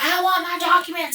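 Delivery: high, whiny-style imitation of his demand; mock demanding man. Man pestering for his documents, mockingly demanding. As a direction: mimic him pestering for his papers.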